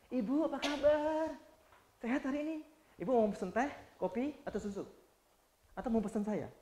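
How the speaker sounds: lightly voiced, soft sing-song steward offering drinks; soft flirty steward. A male steward sweetly offering tea or coffee to a passenger, soft and sing-song. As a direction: coo the drink offer gently. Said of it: "Nadanya begitu".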